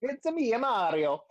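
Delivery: high, bouncy cartoon Italian accent; Mario, comic Italian accent. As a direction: announce yourself in a hammed-up Italian accent. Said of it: "bad Italian accent".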